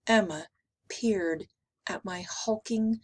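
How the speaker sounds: ordinary delivery, nothing marked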